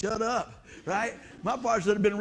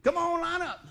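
teacher's order; 8 semitones higher than usual. Retold or imitated. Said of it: "so she said".

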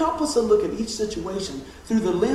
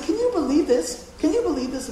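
incredulous; 9 semitones higher than usual. Retold or imitated. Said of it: "I said".

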